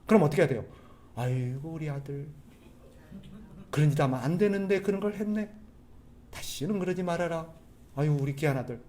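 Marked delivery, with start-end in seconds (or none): 1.2-8.8 soft, indulgent mock scolding; doting parent. Lenient parent to a child who stole, tenderly indulgent. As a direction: coo gently over a naughty darling. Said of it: "그런 부모 있어요".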